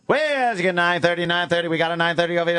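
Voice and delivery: rapid-fire bid calling; auctioneer. Retold or imitated. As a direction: rattle off bids like an auctioneer. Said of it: "how an auctioneer can talk so fucking fast".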